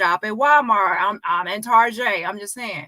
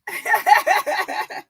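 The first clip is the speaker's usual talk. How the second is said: high, breathless cackling; real laughter; 10 semitones higher than usual. Real, not acted. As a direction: crack up helplessly, very high. Bursts out after the singing.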